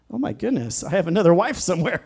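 high, amused exclamation breaking into laughter; laughing. Retold or imitated. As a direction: laugh through the joke about a second wife. Joking about wife's lookalike.

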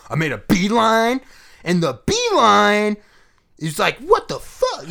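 high, slow comic impression, stretching the words; mock Dane Cook, leaning hard on odd words. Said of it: "It was just emphasis on words".

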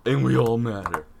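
normal talking voice

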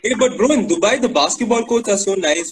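ordinary talk

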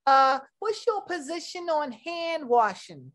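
sweet, prim news interviewer; news reporter voice. Acted. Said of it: "Miss Trudy Tirade".

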